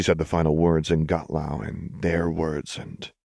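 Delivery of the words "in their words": usual speaking voice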